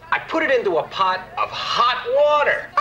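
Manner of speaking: hot punched, a comic's punchline; stress on hot. Shown as a demonstration. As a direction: lean hard on the word hot. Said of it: "You've got to emphasize the word hot more".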